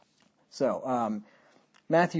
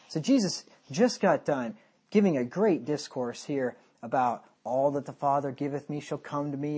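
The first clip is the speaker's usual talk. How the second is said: usual speaking voice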